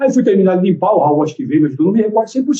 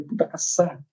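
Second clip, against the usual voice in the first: swearing to himself; 10 dB quieter than usual. Retold or imitated. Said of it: "falando palavrãozinho no pensamento".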